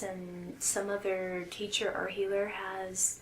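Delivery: ordinary talk, nothing marked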